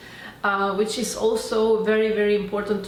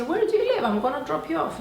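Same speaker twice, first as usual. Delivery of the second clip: eager offer; 7 semitones higher, faster than usual. Retold or imitated. Offering the driver a ride.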